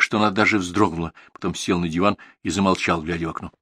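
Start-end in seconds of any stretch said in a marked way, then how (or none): none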